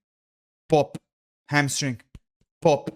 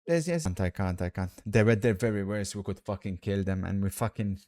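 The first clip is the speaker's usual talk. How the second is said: ordinary delivery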